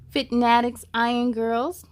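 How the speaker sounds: usual speaking voice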